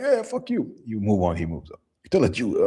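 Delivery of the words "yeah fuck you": mock retort. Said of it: "He's like".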